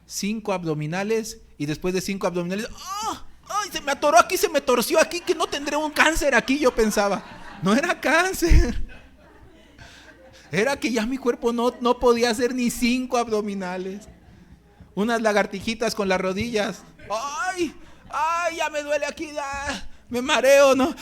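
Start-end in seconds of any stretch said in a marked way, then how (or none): 3.0-12.3 higher, louder hammed-up cries of pain; a mock hypochondriac shrieking over sit-up aches; mock pained panic. Reenacting pain during sit-ups. 17.1-20.8 higher hammed-up yelps of pain; mock whimpers, higher, as if about to faint; mock pained cries. Reenacting pain doing push-ups.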